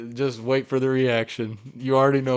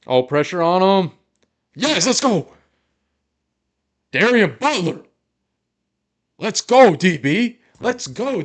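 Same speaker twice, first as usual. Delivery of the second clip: loud, high celebratory yells; real excited shouting; 8 semitones higher, 9 dB louder, slower than usual. Real, not acted. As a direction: shout it loud enough to blow up the mic. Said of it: "blowing up the mic".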